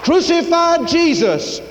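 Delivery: high, loud shout of a mob; preacher's full-voiced imitation of a mob; mob crying out. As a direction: cry it out as a crowd demanding. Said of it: "that fickle mob cried out".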